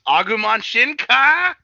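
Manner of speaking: loud, high, slow anime evolution cry; drawn-out hyped battle call; all-caps shout. Acted. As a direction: yell it as if written in capitals. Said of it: "my next note in all caps".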